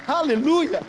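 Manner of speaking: high, loud shouts in punched bursts; full-force preaching. Real, not acted. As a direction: proclaim it to the congregation, drawing out each promise. Preaches the climax. (pregação aos brados, com força total)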